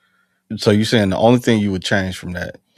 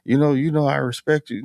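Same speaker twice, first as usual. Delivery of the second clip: mock Johnson; 7 semitones higher than usual. Marine retelling buddy's shrug-off, imitated higher voice. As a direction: toss it off like he doesn't care. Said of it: "it's like".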